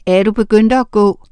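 usual speaking voice

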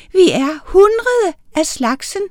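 a tiny witch answering proudly, up high; little witch voice. A narrator's reading. Tiny witch tells Amalie how many they are, high voice. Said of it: "forklarede hun".

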